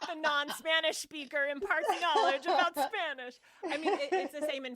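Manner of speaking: loud, high laughing remark. Real, not acted. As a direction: laugh through the words. Laughing at the irony.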